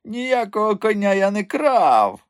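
high, drawn-out indignant denial; protesting innocence in a high, slow voice; sincere indignation. A narrator's reading. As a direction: deny it slowly, pitch way up. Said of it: "щиро обурювався він".

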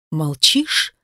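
a hint of a threatening gangster; menacing gang boss. A narrator's reading. Gang boss presses her.

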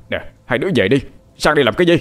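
yelling. A narrator's reading. Kneeling father sending his two children home, yelling. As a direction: yell at them to get away. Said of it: "ông Cố gào lên".

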